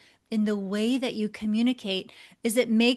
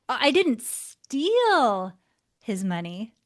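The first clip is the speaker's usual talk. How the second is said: "steal" diucapkan lambat dan ragu, "his money" lebih pelan; slow, hesitant, stress on steal. Shown as a demonstration. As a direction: ucapkan "steal" dengan lambat dan ragu lalu turunkan suara. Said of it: "you can see how the same words deliver a very different message".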